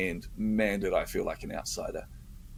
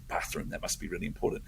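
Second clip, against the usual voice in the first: whispered mock aside; 3 semitones lower, 9 dB quieter than usual. Mock note-taking aside.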